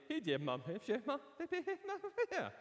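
put-on climbing, flustered stammer at the girl; mock tongue-tied babble, rising high. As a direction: trip over the words like a nervous boy. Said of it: "it came out like".

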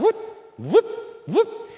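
high squeaky whoop noises; mock the darting glance with a high whoop; sing-song cartoon whoop; whoop sound effect. Mimicking eyes darting up.

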